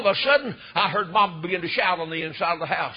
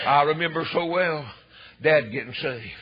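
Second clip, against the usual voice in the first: usual speaking voice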